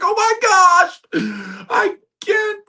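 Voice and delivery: very high, loud wail; hammed-up panicked wailing, words stretched; mock despair. Sinking Peter loses his belief, mock despairing wail. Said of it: "We all feel that way".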